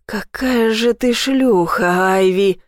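slow, growling insult; growled. A narrator's reading. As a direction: growl it out slowly. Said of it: "прорычал он".

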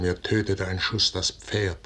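ordinary delivery

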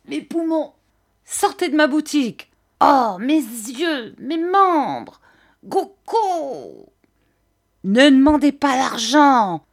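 high, loud orders mixed with wails; shouting old man. A narrator's reading. Old man drives seller from his shop, high loud shouting. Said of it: "cria le vieillard en jetant la veste".

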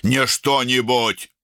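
high, drawn-out angry correction; barked. A narrator's reading. Older brother rejecting his brother's answer, barked. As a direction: bark it back, stretching the words. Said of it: "рявкнул Арун".

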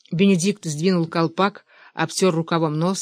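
ordinary delivery

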